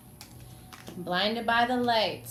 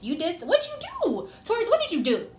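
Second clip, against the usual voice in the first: mock shocked scolding; 10 semitones higher, 3 dB louder than usual. Scolding Taurus playfully, laughing.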